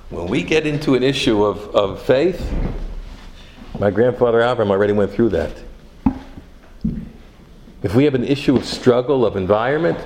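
loud, driving sermon cadence; preaching at full force. Acted. Rabbi preaching to his congregation, loud and driving.

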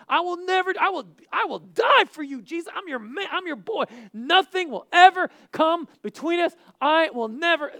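high, loud pleading pledge; impassioned acted outburst; fervent vow. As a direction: swear undying loyalty at full voice. Acted Peter swearing loyalty.

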